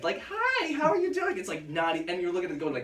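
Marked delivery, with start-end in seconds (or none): 0.3-1.4 much higher, breezy mock American accent. Mocking a daughter's sudden American accent, breezy and high. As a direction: flip into a cartoonish American accent. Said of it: "Like, that accent".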